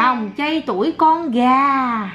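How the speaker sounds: word by word, slow and drawn out; patient, stretched-out correction; slow teaching. Shown as a demonstration. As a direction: spell it out slowly for a toddler. Teaching child the answer.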